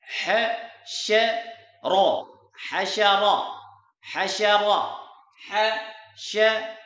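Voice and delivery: slow syllable drill. Shown as a demonstration. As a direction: spell out each word slowly like a teacher. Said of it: "teacher drills syllables slowly". (उस्ताद की तरह बहुत धीरे हिज्जे करके)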